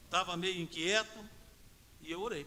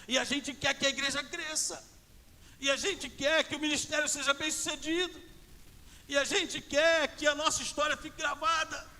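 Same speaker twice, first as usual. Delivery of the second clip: loud, raised, driving sermon voice; full-force preaching; 6 semitones higher, 7 dB louder than usual. Real, not acted. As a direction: build to a high, forceful exhortation.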